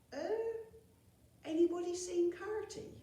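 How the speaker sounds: high, hesitant question; high dopey wondering; puzzled goblin. Acted. Dribbledraw puzzled.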